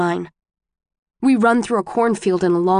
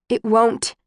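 firm, louder repeat of "It won't"; 3 semitones higher than usual. A narrator's reading. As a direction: push "It won't" out louder and firmer. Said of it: "louder this time".

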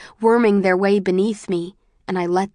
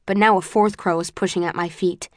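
ordinary delivery, nothing marked

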